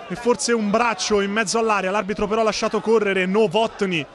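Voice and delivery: normal talking voice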